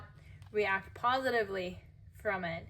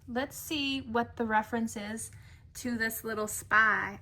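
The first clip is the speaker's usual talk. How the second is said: ordinary delivery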